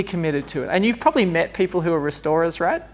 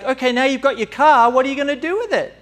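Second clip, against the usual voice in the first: high, louder, quick eager ask; eager question; 8 semitones higher, 6 dB louder than usual. Retold or imitated. Someone asking God about the car, high and eager. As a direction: pepper God with an excited question. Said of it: "It's like".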